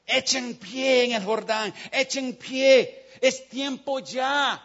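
very slow, stressing pie; God urging the church into missions; slow emphatic call. Acted. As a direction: stretch out each word of the summons.

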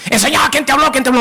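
hammed-up jealous interrogation; mock jealous demand. Jealous spouse demands phone.

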